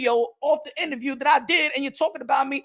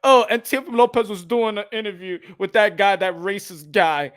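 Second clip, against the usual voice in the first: mocking some guy; 6 semitones lower than usual. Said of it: "some guy gonna be like".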